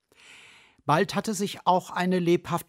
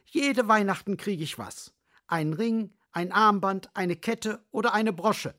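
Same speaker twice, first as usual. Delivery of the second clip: higher greedy magpie; 6 semitones higher than usual. Acted.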